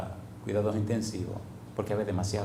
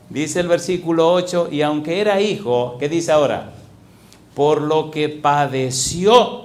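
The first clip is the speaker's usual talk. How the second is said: loud, driving sermon voice, stretching the quoted verse; preaching at full force; 3 semitones higher, 5 dB louder than usual. Acted. Pastor reading Hebreos to the congregation, loud fervent preaching. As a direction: hammer the verse home to the congregation.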